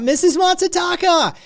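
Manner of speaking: high excited call across the stall; eager boy yelling a greeting. Acted. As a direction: shout her name from afar, high and loud. Jigu calling out to her.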